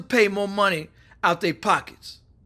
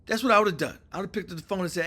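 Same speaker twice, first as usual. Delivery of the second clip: ordinary delivery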